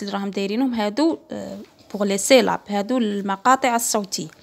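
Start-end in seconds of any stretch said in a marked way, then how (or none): none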